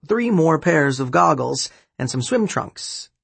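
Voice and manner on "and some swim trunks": plain reading voice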